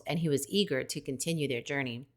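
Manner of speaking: ordinary delivery, nothing marked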